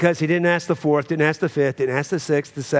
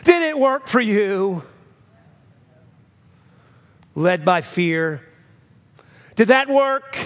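high, louder challenge; challenging preaching; 9 semitones higher, 4 dB louder than usual. Acted. Pastor challenging the church about fear and worry, challenging preaching. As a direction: press them with each question. Preacher fires rhetorical questions.

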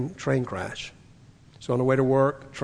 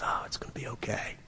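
hushed reassurance; 3 semitones lower, 11 dB quieter than usual. Retold or imitated. Quoting calm confidence in trouble.

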